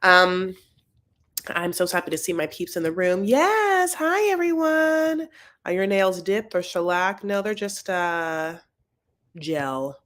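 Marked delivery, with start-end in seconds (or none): 3.2-5.3 excited hello. Real, not acted. Greeting live chat viewers.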